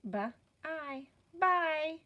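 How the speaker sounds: "B" as a short sound, "I" separate, then "bye" blended. Shown as a demonstration. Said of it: "go back and touch our sounds".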